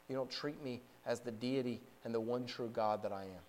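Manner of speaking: low, soft, grieved rebuke; grave divine reproach. Acted. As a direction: voice the Lord's reproach quietly and low. Voices the Lord reproaching the people.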